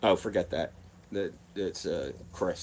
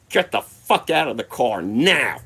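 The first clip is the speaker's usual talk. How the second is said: loud, higher-pitched cop's order; commanding yell; 4 semitones higher, 8 dB louder than usual. Retold or imitated. Cop at the back door to passenger, commanding yell. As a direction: yell it as an urgent police command.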